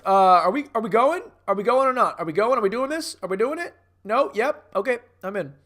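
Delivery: loud, high, impatient badgering; [name]. Meathead pestering the crew about the job, loud impatient impression. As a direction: pester the crew with rapid, rising questions. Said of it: "I'm [name] being like".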